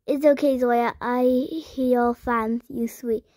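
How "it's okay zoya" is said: ordinary talk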